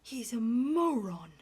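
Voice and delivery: loud disgusted verdict, stress on moron; contemptuous outburst. Acted. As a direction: spit out the judgment. Duke's horrified verdict.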